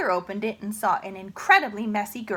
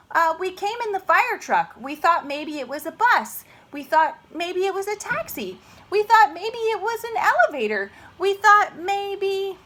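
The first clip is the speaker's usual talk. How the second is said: nervous excuses; 9 semitones higher, 5 dB louder than usual. Acted. Sheila making excuses.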